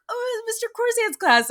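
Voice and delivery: high, bright mock excitement; recalling the teacher at the reunion, high mock glee; excited recognition. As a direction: squeal it like spotting something fun. Retold self at reunion.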